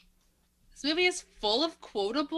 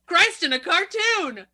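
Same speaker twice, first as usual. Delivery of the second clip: louder, higher and drawn out; big slow mock exclamation; 8 semitones higher, 9 dB louder, slower than usual. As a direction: belt it out like a corny action hero.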